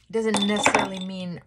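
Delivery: normal talking voice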